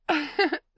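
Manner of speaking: bright giggling laugh; loud playful giggle. Acted. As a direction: laugh it off, caught out. Laughing at her own slip.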